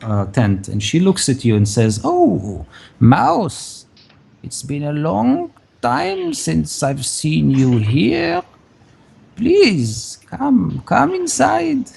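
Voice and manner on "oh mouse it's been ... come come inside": put-on high, slow old crone voice; creaky welcoming old woman. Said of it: "you can see a very old woman".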